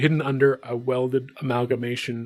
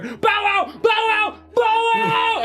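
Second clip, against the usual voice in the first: much higher, shouted bow wow; shouting dog barks; slower than usual. Acted. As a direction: bark wildly from the other room.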